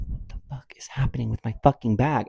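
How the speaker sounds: fast, exasperated whisper; retold whispered outrage. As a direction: whisper it fast, as if under your breath. Retold muttered reaction to attendant.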